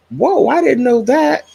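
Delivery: high-pitched, wowed exclamation; mock astonishment, pitch swooping up; amazed reaction. Telling subscribers how their knowledge strikes her, amazed.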